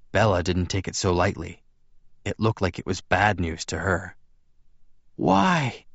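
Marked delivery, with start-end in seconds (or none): 5.2-5.9 high, breathed female character question; breathed in dismay. A narrator's reading. Bella takes the bad news, high breathed question. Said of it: "she breathed".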